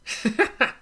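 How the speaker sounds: high burst of laughter; laughing. Real, not acted. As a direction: laugh out at the disagreement. Amused by the argument.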